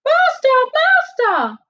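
very high, loud alarm call; crying out. A narrator's reading. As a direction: cry out like a magic harp. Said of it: "the harp cried out".

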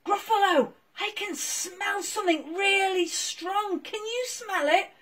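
a cold little dog talking; puppy Marshall. Acted. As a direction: Marshall shivering in the snow.

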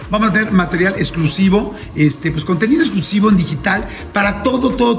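loud, upbeat host pitch to subscribers; hyped channel promo read; promo announcement. Acted. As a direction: sell it to the YouTube audience.

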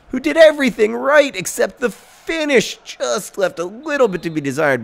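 high, loud, anguished sportscaster call; mock commentator. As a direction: wail the near miss like a heartbroken announcer. Mock commentator on a near miss.

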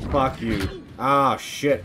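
loud, high swearing in real fright; startled cursing. Real, not acted. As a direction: curse out loud right after the jump scare. Jump scare in game.

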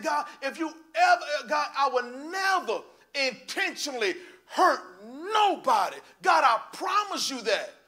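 loud, high, drawn-out vow to God; retold hollering prayer. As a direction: cry out a desperate promise, slowly. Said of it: "I heard you hollering and screaming".

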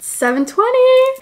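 high, slow surprised exclaim. Real, not acted. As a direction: gasp out the time. Surprised at the time.

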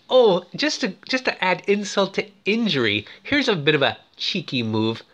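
ordinary talk

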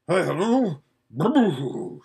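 horse spluttering awake; groggy horse waking. Acted. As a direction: snort and splutter as you wake.

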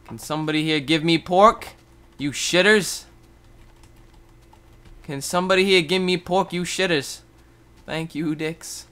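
loud high jeering call; bratty shouted request; mock demand. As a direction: yell at the other players. Demanding pork from players.

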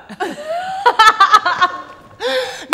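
long, loud, high laugh; real burst of laughter. Real, not acted. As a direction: burst out laughing at your own joke. Laughs at her own retort. (gargalhada alta e aguda)

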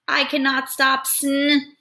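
nasally, about to sneeze; nasal sneezy voice. Acted. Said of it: "You see how I'm changing my voice?".

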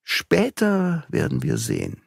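musing, pitch rising then settling; thoughtful. A narrator's reading. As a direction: think it through aloud, pausing between phrases. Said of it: "sagte Beautrelet nachdenklich".

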